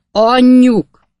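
very loud, high yell; high-pitched, full-force shout; big bellow. A narrator's reading. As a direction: holler for the wife to come out. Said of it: "ordított egy nagyot".

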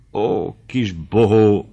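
slow, drawn-out fond delight; mild lover's rapture; joy-drunk exclamation. A narrator's reading. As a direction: exclaim tenderly, savouring each word. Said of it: "kiáltott fel Noszti örömittasan".

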